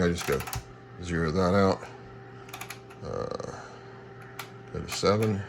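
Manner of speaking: normal talking voice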